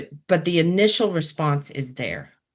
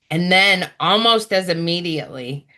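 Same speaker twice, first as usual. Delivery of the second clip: normal talking voice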